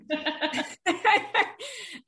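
a high laugh at yourself. Real, not acted. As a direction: laugh off the ramble. Laughing at her own tangent.

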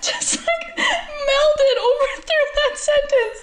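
high and slow, through laughter; barely getting the words out laughing; real helpless laughter; laughing hard. Real, not acted.

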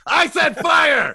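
high, loud, slow yelled correction; angry shout. Retold or imitated. Retelling the pissed-off plumber, a slow furious shout. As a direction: bellow it like an exasperated worker. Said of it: "it really pissed the first guy off".

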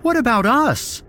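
high, loud, frantic begging; panicked pleading. A narrator's reading. Kendrick begs Mara to leave with him, panicked. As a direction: beg them desperately to go.

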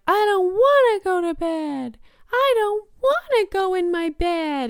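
high, loud whiny mock child's yell; imitating yelling child. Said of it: "yells over and over again".

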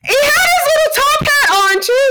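very high squealed delivery; real high-pitched, giddy excitement; giddy reveal. Real, not acted. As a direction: squeal the detail out in delight. Excited reveal of a funny detail.

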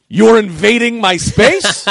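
high, slow, loud indignation; mock outrage. High guy shutting down his scene partner, outraged. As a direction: accuse them, stretching each word. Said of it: "he thought I was serious".

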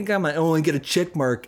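usual speaking voice

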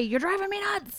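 high, exasperated parent voice; mocking parent. Podcast host mimicking annoyed parents, high and exasperated. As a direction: play a fed-up parent scolding their kid. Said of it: "people are probably listening to this being like".

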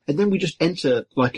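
usual speaking voice

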